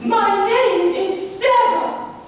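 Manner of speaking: slow, high, sing-song lady voice; mock lady's voice. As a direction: drawl it like a coy lady. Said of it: "imagine you are Miss Sarah Hampton".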